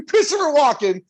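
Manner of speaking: high, loud mock Walken; a clumsy, overdone Christopher Walken; Christopher Walken impression. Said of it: "that's the worst Walken ever".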